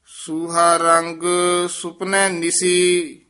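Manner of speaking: measured chant, held line endings; scripture recitation. Acted. Teacher leads students through Gurbani, devotional recitation. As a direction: recite the verse slowly on a near-level note.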